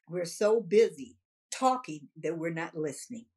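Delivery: ordinary talk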